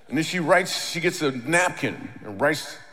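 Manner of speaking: usual speaking voice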